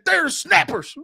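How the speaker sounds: high, emphatic local voice; mock New Englander. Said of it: "So we said".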